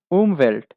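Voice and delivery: উমভেল্ট loud with a falling tune; German word demo. Shown as a demonstration. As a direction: model উমভেল্ট for the class. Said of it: "উমভেল্ট অর্থ". (German উচ্চারণে উমভেল্ট)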